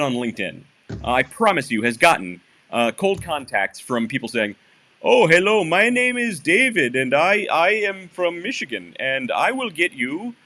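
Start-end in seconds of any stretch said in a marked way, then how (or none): 5.0-10.3 slow, drawn-out mock Michigan accent; bad Michigan accent. Mimicking Michigan cold-callers on LinkedIn, bad accent. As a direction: badly fake a Michigan accent, stretching it out. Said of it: "I'm doing a bad Michigan accent".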